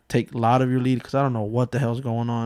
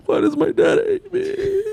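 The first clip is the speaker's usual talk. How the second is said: high, slow, whimpering sob; hammed-up wail of a hurt child; mock crying; slower than usual. Boy crying in his room over dad, mock crying. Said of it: "I used to cry in my room".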